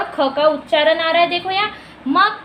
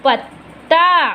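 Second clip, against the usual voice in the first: "पत्ता" split at the double त, very slow; slow, deliberate "पत्-ता"; sounding out; much slower than usual. Shown as a demonstration. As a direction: dictate "पत्ता" in two parts, final vowel long. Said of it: "इस तरह हमें चित्र देखकर".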